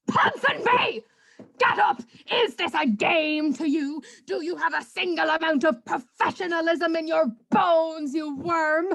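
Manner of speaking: barking orders, shouting; drill sergeant. Acted. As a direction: yell like a furious drill sergeant. Boss yells at Tarquin.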